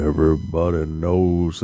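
a light imitation of a low droning singer; half-hearted low monotone croon. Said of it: "like she's [name]".

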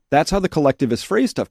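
ordinary talk

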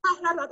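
very high startled yelps; flustered squeaks. Retold or imitated. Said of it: "She's like".

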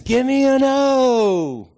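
high, slow, full-volume cheer call; cheerleader yell. Retold or imitated. Former mascot fooling Georgia fans, drawn-out cheer yell. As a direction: shout it out and stretch the letter. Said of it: "And then he goes".

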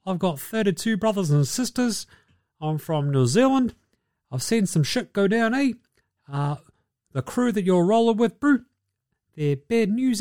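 a hint of laid-back New Zealand big man; mock New Zealand accent. Steven Adams warning Ja about his crew, mild New Zealand accent. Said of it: "Steven Adams... you go".

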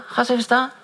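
high, sharp command; mock leader's order; ordering the boy up. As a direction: make him stand up in front of everyone.

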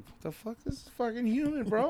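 high-pitched disgusted squeal, hammed up; mock shocked warlord. Warlord tasting human meat.

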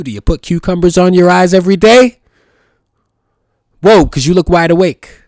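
loud incredulous teasing; loud taunting question; mock loud challenge. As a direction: call them out loudly. Mocking the self-care claim.

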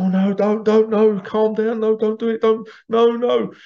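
high, rising, panicky begging; frightened pleading. Acted. Scared ants begging the one ant to stop, panicky high pleading.

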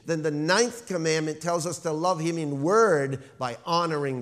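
loud, emphatic sermon delivery; ringing pulpit delivery, key words punched; forceful preaching. Real, not acted. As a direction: preach it out to the whole church.

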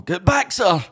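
lightly acted alarm from a staggering soldier; urgent warning. Wounded dragoon warning his colonel of a trap, urgent.

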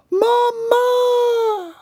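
put-on very high, long child's cry; wailing child. A narrator's reading. A frightened child cries for mother, wailing. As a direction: wail out for mother.

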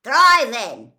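loud, cheerful shout across the hall; a friendly giant yells happily to the boys; happy call back. Acted. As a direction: boom it back with pride. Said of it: "Hagrid called back happily".

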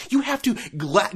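ordinary talk, nothing marked

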